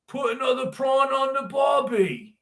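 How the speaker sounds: put-on Australian accent. Correcting shrimp to prawn, exaggerated Australian accent. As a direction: sing out a broad mock-Australian line. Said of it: "Not prawn".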